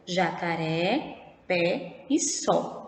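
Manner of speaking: "Jacaré, pé e sol" slowly, final vowels wide open; open vowel sound. Shown as a demonstration. Teacher has pupils listen to the sound, slow open vowels. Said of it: "ele é um som aberto".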